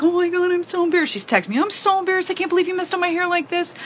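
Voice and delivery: high, whiny embarrassed mom. Retold or imitated. Mom texting her daughter, high and whiny. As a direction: whine it out, mortified. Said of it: "And she's like".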